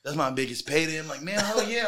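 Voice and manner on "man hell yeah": higher-pitched mock excitement; an eager yes, lightly acted; retold eagerness. Acts eager reaction to pay offer.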